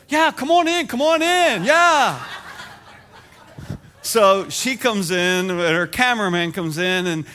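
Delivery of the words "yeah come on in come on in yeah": much higher, loud, laughing welcome; wife waving the crew in eagerly. Retold or imitated. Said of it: "my wife goes".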